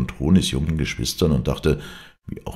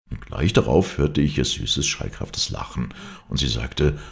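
plain reading voice

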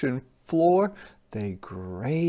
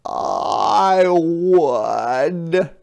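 higher, louder spiny voice; sea urchin voice; 5 semitones higher, 9 dB louder than usual. Acted. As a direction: answer boldly like a prickly urchin. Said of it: "answered a spiny sea urchin".

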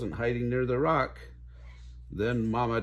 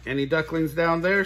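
calling, a bit higher and louder; 5 semitones higher, 4 dB louder than usual. A narrator's reading. Mama Duck calling out to her hidden ducklings. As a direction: call out across the pond. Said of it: "she called".

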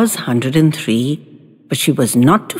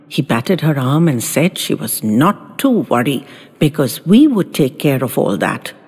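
ordinary delivery, nothing marked

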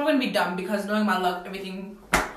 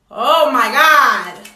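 loud drawn-out exclaim; 13 dB louder, much slower than usual. Real, not acted.

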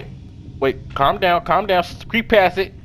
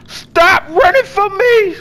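high, slow fake crying plea; hammed-up teary whine, higher; mock whine; 10 semitones higher, 4 dB louder, slower than usual. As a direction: whine like a hurt sibling. Begging a fleeing character.

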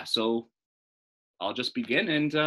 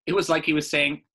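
usual speaking voice

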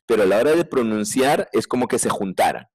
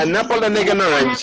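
higher, rushed list with a laugh; fast with a laugh; 8 semitones higher, 4 dB louder than usual. Shown as a demonstration. Teacher repeats at top speed for the class, laughing. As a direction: race through it, chuckling. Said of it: "velocidad corre caminos".

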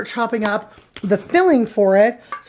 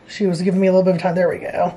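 ordinary talk, nothing marked

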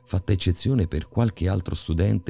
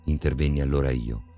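ordinary delivery, nothing marked

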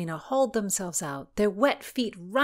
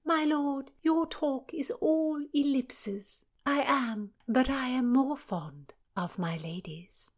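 arch princess; 8 semitones higher, slower than usual. Acted. Cecilia witty retort.

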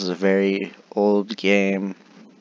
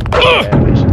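very high, loud squeak; squeaky high voice; 11 dB louder, faster than usual. Real, not acted.

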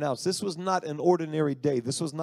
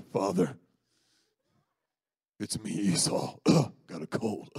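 Jacob pushing his voice lower to pass as Esau; faked manly voice; 5 semitones lower than usual. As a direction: clear the throat, then go low to pose as the brother. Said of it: "Esau has a manly voice".

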